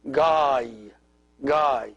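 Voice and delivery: Gai held, gliding into an English Y; pronunciation demo. Shown as a demonstration. Teacher repeats the syllable for learners, Gai sounded out. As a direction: say Gai as one drawn-out syllable. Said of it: "pronounced like the English Y".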